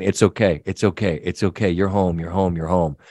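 calm the frightened dog gently; soothing murmur. Retold or imitated. Owner rubbing the dog's belly, soothing him, low and tender. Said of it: "I just kept saying".

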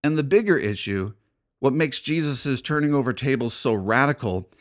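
ordinary talk, nothing marked

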